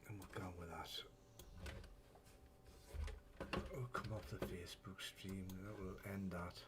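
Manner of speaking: very quiet, low, halting murmur; muttering to himself. Real, not acted. Streamer shutting down the Facebook stream, muttering to himself. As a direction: talk yourself through a fix under your breath.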